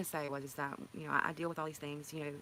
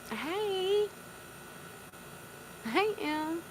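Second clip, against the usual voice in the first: delighted greeting; 8 semitones higher than usual. Real, not acted. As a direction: squeal a welcome to a friend arriving. Greeting a viewer joyfully.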